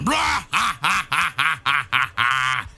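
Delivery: long staccato evil laugh; mocking dragon cackle; villain's cackle. Dragon laughs at crying boy, wicked cackle.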